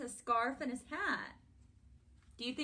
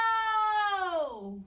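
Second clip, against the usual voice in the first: chanted lead-in bursting into a huge long hello; sing-song chant into a big high holler; as loud as possible; 7 semitones higher, 10 dB louder than usual. Shown as a demonstration. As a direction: belt out the hello as loud as you can. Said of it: "as loud as we can".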